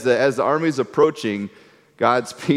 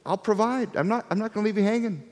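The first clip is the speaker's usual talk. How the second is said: higher and softer, tender reassurance; gentle God; 8 semitones higher, 6 dB quieter than usual. Retold or imitated. God answering complaining people, high and soft. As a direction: promise it gently from above. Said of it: "what does God say".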